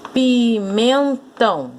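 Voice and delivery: "pimentão" slowly split into its syllables. Shown as a demonstration. Teacher separating "pimentão" for pupils, slow and syllabified. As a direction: stretch each syllable of "pimentão" apart. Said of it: "você vai separar a sílaba aqui".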